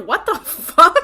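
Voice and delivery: high, loud mock bewilderment; incredulous outburst. Bobby overhearing the yelling in the house, mock incredulous outburst. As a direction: blurt it out loudly, acting baffled, near laughter.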